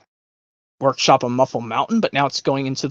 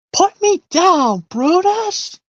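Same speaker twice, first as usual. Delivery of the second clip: very high, louder, slow villainess bellow; high, loud, slow cartoon villain command; villainess shouting orders; 4 dB louder, much slower than usual. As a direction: order the crocodile to let go. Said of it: "Yep, from the rescuers".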